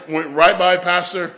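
usual speaking voice

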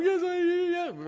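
put-on high, sobbing little-girl voice; imitating a crying daughter. Father mimicking his daughter sobbing after being scolded. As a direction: blubber it out like a tearful child. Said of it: "she was crying".

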